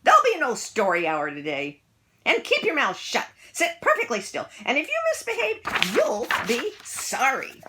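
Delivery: loud, high, harsh threats; witchy teacher. A narrator's reading. Miss Swamp threatening the class, loud and harsh. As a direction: lay down the law like a witch.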